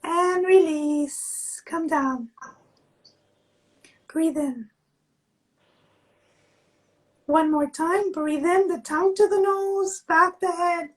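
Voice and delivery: slow, calm instructor voice; guided exercise. Acted. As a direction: guide the class gently, drawing words out. Leads a face yoga exercise.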